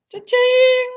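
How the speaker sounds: high, loud cash-register cry; excited cha-ching. Real, not acted. Streamer thrilled at a gift, high loud cheer. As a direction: ring it out like a jackpot win.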